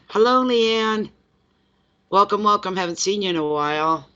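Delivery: stretched, lilting hello to a viewer; warm greeting. Real, not acted. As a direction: greet a friend you've missed.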